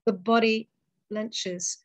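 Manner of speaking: measured, solemn poetry reading with long pauses; poem recital. Acted. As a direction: let each line land, then hold the silence. Reads her poem aloud.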